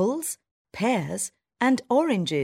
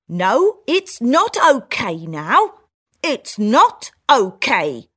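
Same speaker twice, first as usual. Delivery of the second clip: louder, slow firm refusal; country mouse protesting; 4 semitones higher, 8 dB louder, slower than usual. Acted. Country mouse refusing the town mouse, loud and slow. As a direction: put your foot down. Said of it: "says the country mouse".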